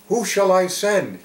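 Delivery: high, loud call for a volunteer. Acted. God to the world, seeking a prophet, calling out. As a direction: ring it out as a summons.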